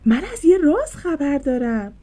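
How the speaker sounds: loud, bursting announcement; excited shout. Acted. Maya shouting her secret to Mimi, loud and excited. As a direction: blurt the news out loud. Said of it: "فریاد زد".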